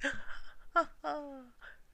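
high evil troll cackle; troll laugh. Acted. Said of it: "Sid laughed".

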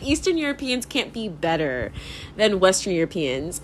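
ordinary talk, nothing marked

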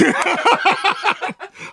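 high burst of laughter. Real, not acted. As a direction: break into laughter. Laughing at own story. (大笑い)

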